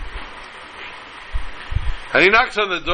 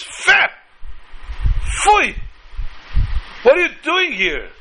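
acted scandalized scolding, building to a squawked rebuke; outraged disbelief; 3 semitones higher than usual. Shocked hostess to the supposed rabbi, increasingly outraged.

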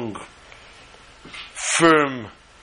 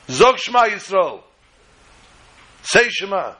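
commanding officer; 8 semitones higher than usual. Retold or imitated. Commander demands the prayer.

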